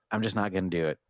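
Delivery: ordinary delivery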